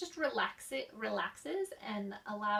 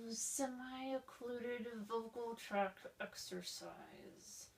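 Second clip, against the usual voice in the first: very slow, softer words voiced through a thin straw, "Semi-occluded vocal tract exercise" buzzing steadily; 6 dB quieter, much slower than usual. Shown as a demonstration. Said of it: "I would say it like this".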